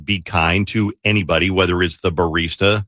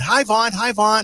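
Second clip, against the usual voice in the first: high called-out greeting; mock yell; 14 semitones higher, slower than usual. Host imitates the caller yelling out a greeting to Vaughn. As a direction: call his name across the crowd, pitched high. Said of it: "she yells out".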